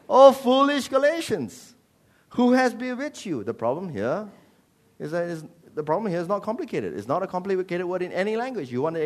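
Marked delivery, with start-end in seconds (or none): 0.1-3.4 exclaimed rebuke. Acted. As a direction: cry out in exasperated reproach. Acts Paul rebuking Galatians.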